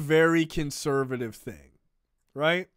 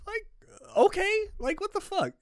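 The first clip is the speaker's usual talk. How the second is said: high, disbelieving what the fuck; incredulous; 5 dB quieter than usual. Real, not acted. Host reacting to Dean Cain's boast, incredulous and high.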